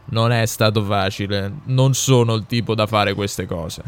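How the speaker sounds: voce lievemente impostata da dirigente; amministratore delegato. A narrator's reading. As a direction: presentati e congratulati da dirigente, misurato. Figura dell'amministratore delegato. (dirigente in visita, accenno di voce impostata)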